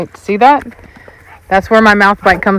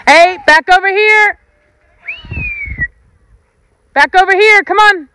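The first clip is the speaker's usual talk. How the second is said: much higher, ringing call; calling the dog back; 11 semitones higher than usual. Real, not acted. Owner calling the dog back from across the yard, high ringing call.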